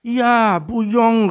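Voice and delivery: very high, very loud cheer; delighted shout. A narrator's reading. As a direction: shout it out as the thrilled little tiger. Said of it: "rief der kleine Tiger". (begeistert gejubelt)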